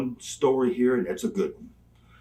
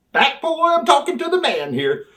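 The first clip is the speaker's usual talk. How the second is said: high, louder brush-off; mock skit shout; 14 semitones higher, 5 dB louder than usual. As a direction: play out the shove-off loud. Acting out both roles of skit.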